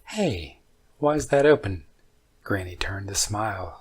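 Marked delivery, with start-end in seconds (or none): 0.1-1.9 sudden alarmed outburst, louder; startled, raising the voice; surprised. Acted. Sees an open cabinet, alarmed.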